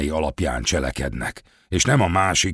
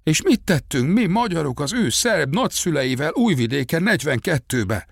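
mild imitation of shouted reproach; retold shouted accusation; 8 semitones higher than usual. A narrator's reading. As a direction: throw the accusation out in a raised pitch. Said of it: "Ő meg azt kiabálta".

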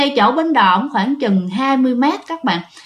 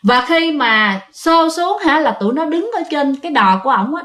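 ordinary delivery

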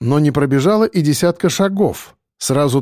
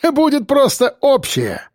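high jeering laugh; guffawing; 11 semitones higher than usual. A narrator's reading. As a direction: sneer it through laughter. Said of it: "захохотал третий".

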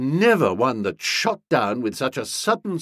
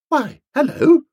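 high, louder surprised hello; surprised greeting; 7 dB louder than usual. A narrator's reading. Nobby greets Bertie at the sight of him, surprised. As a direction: greet him with startled pitch lift.

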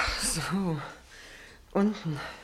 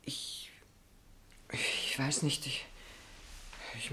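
startled, breathless whisper; hushed and rattled; 2 semitones lower, 16 dB quieter, slower than usual. Acted. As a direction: barely get the words out, caught off guard. Flustered woman stammers to stranger.

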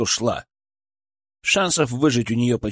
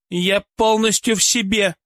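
высокий, холодный отпор; холодно защищать себя; 7 semitones higher than usual. Acted. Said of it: "холодно заступилась за себя Ольга". (холодно, с вызовом)

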